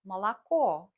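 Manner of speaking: молоко said mo-lo-KO, stress on the final o; moloKO, lean on the end; stress on the last syllable. Shown as a demonstration. Teacher shows learners: молоко stressed on the end. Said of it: "For example".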